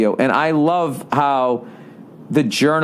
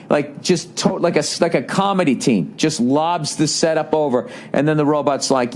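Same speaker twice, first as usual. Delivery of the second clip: normal talking voice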